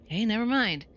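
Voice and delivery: usual speaking voice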